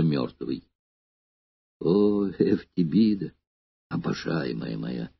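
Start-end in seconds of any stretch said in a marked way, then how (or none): 1.8-5.1 faint, slow dying whisper; fading breath of a dying man; deathbed whisper. A narrator's reading. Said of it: "шептал Эномай слабеющим голосом".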